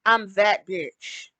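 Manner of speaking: slow, drawn-out, swaggering "I'm that bitch"; quoting Jade Cargill. Retold or imitated. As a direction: lay it down slowly like a catchphrase. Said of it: "When Jade Cargill says".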